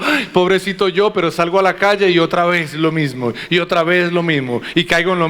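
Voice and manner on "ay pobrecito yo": high whiny mock sigh of self-pity. Preacher mocking a churchgoer who cries, then repeats sin: whiny self-pity. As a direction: lightly mimic a self-pitying churchgoer. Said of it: "Vengo acá, lloro".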